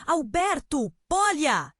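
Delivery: loud, high call by name; grito. A narrator's reading. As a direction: shout out to get his attention. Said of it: "Ana gritou". (chamado gritado, alto e agudo)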